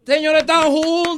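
loud, high, drawn-out proclamation; slow celebratory radio yell; triumphant announcement. Real, not acted. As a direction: shout the happy ending to everyone. Host celebrates the couple's happy ending.

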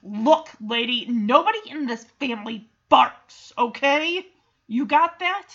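loud, emphatic telling-off; forceful, fed-up retort, hitting each phrase; worked up. Retold or imitated. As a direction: put a stranger firmly in her place. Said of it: "really getting worked up".

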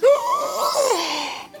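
very high exaggerated "oh" squeal; long mock gasp-shriek of horror; shocked mother's shriek. Mom hearing her son was hit, shocked shriek. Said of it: "She's like".

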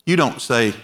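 normal talking voice

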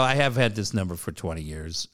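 acting out backing off, low and controlled; retold calmed-down apology. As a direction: explain it reasonably after having been rude. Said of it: "no reason for me to yell at you".

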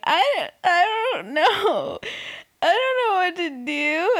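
high, drawn-out fake sobbing wail; mock wailing. Imitating JoJo trying to get tears out, mock wailing. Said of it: "wailing, trying to get, like, tears".

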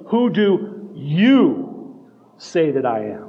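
slow question with a big drawn-out "YOU"; emphasized YOU. Acted. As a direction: stretch and punch "you", pausing before the rest. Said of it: "It means it's emphasized".

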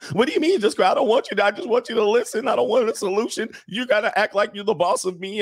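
high-pitched mock protest; whiny hammed-up female complaint; indignant woman. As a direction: snap back like an offended girlfriend, voice way up. Acts woman rejecting man's advice.

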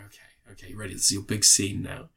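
very soft, half-whispered prompt; hushed cue. Retold or imitated. Performer cueing the volunteer for his big scene, hushed. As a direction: murmur it as if backstage.

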